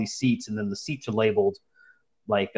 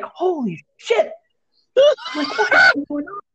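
alarmed; 4 dB louder than usual. Retold or imitated. Cornered on the train, squeaking in shock at the stranger. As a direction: let it rise way up in disbelief.

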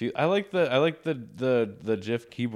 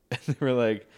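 ordinary talk, nothing marked

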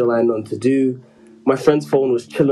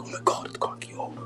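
hushed mock-scandalised whispering; gossipy whisper; 12 dB quieter than usual. Classmates walking past him and the pastor, gossipy whisper. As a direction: imitate onlookers whispering behind someone's back.

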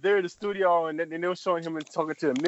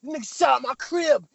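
loud, higher angry street voice; 9 semitones higher, 4 dB louder than usual. Retold or imitated. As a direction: come in hot, accusing. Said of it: "here go Ghostface".

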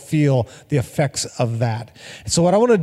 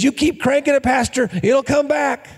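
put-on higher kid voice, knowing and cheeky; little boy voice; 9 semitones higher than usual. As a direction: play the kid calling back to the pastor. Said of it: "And he, he says".

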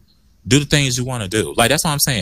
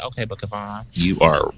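low scolding self-talk; 4 semitones lower than usual. Retold or imitated. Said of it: "I'm like".

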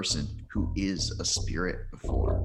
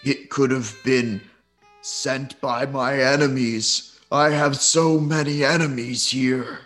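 louder lion spirit voice; unearthly voice; 4 semitones higher, 4 dB louder than usual. Acted. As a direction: speak as the forest spirit. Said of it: "says the spirit".

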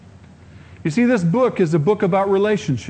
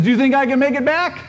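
playful showman; 9 semitones higher, faster than usual. Retold or imitated. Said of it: "He says".

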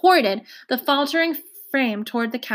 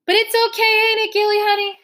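put-on 1 anxious old woman; 11 semitones higher, 4 dB louder than usual. A narrator's reading. Worried old woman pleading on floor.

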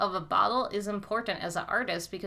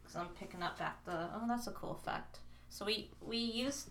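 far from the mic, much softer; distant voice; 3 semitones higher, 10 dB quieter than usual. Real, not acted. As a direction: speak from across the room. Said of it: "I'm walking far away from the mic in case my voice sounds far away".

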